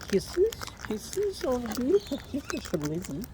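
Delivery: squeaky high cooing at the birds. Real, not acted. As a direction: coo kisses up high like to a pet. Baby talk to pet birds.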